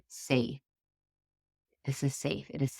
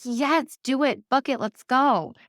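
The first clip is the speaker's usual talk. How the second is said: high, loud eager urging; excited yes; 9 semitones higher, 5 dB louder, slower than usual. Retold or imitated. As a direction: pump the friend up to book it. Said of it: "And I was so excited".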